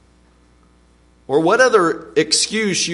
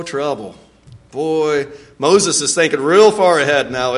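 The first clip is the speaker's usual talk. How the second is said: ordinary delivery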